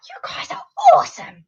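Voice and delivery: very high squeal of delight; squealed praise. Real, not acted.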